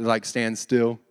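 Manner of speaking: normal talking voice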